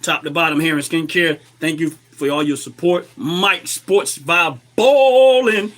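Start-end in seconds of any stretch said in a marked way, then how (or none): 3.2-5.7 louder, slow drawn-out shout-out. Real, not acted. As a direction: call the name out proudly. Shouting out a viewer's name.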